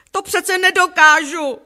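high, loud wail of protest; squealing, overwhelmed outcry; shrieked protest. A narrator's reading. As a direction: shriek in helpless panic. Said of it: "zaječela jsem".

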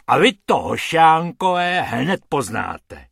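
loud warning that slows at the end; blustering, irate menace; angry threat. A narrator's reading. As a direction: threaten them, landing heavily on the last words. Hrubec threatens the rabbits.

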